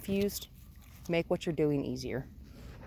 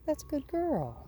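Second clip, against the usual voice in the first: high, slow praise; 11 semitones higher than usual. Real, not acted. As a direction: coo the praise slowly to the dog. Praising the dog.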